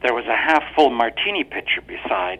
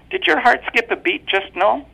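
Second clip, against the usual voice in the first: much higher, mocking wife's question; teasing wife; 14 semitones higher, 6 dB louder than usual. A narrator's reading. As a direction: needle him with a sweet higher voice. Wife voiced in story.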